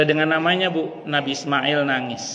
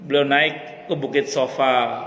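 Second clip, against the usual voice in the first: ordinary talk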